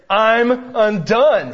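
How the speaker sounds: slow, loud and high cry of being undone; despairing cry. Retold or imitated. Father to his kids at dinner, a slow loud cry of despair. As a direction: let the words break out of you, drawn out. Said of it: "I look at my kids and I say".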